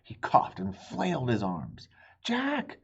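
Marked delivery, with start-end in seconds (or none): 2.2-2.8 high rising call of a name; high rising shout from above. Acted. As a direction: call down into the moat. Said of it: "was calling from above".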